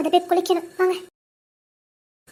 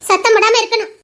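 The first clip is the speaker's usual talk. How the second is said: scolding; 4 semitones higher, 7 dB louder than usual. Acted. Wife scolding husband.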